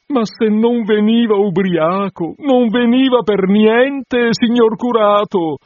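high, slow, tearful pleading; through sobs. Acted. Bride begging the curate through sobs. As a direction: sob the words out to the curate. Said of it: "disse lei fra i singhiozzi".

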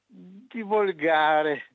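drawn out slowly; slow emphatic. Real, not acted. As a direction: stretch the word in disgust. Offended caller, dragged word.

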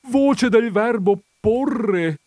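high, slow, strained explanation; faint thread of voice. A narrator's reading. Countess explaining the verb before fainting, thread of voice. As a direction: squeeze it out high and drawn out. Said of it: "con un fil di voce".

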